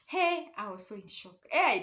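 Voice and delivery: normal talking voice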